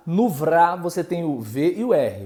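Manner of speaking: ordinary delivery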